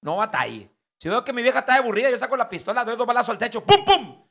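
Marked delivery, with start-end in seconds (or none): 1.0-3.6 louder and higher, bragging compadre; boastful excited telling. Acted. Compadre brags to his friend, excited and loud. 3.6-4.3 loud, high vocal pum pum bangs; gunshot imitation. Husband fires the pistol at the ceiling, shouted bangs.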